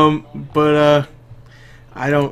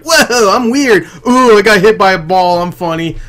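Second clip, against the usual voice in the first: high, loud hammed-up zany voice; mock goofy sidekick; 5 semitones higher, 5 dB louder than usual. Mocking comedic hijinks friend in Transformers, goofy voice. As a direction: play the annoying comic relief, falling off.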